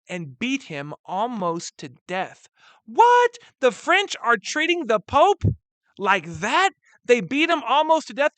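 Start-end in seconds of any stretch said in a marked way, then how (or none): 2.9-8.3 much higher, louder incredulous outburst; shocked outrage. Acted. Teacher reacts to the French beating the Pope, shocked disbelief. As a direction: exclaim in stunned, theatrical disbelief.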